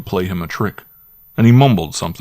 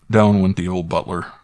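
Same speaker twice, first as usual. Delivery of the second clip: plain reading voice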